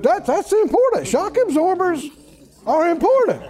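high-pitched sarcastic insistence; mock importance. Mocks the scoffers' double standard.

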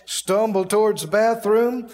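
usual speaking voice

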